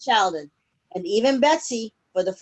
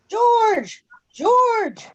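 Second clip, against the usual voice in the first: shouting George; 10 semitones higher than usual. A narrator's reading. Nurse chasing the runaway go-kart, shouting. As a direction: call after him down the hall. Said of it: "she shouted".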